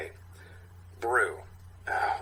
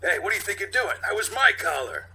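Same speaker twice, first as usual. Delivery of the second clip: indignant youth; 9 semitones higher, 5 dB louder than usual. Acted. Youth caught, character voice.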